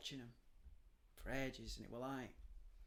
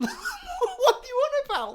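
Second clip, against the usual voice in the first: high, loud laughing question; real laughing disbelief; laughing incredulous; 14 dB louder, slower than usual. Real, not acted. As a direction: laugh at the absurdity.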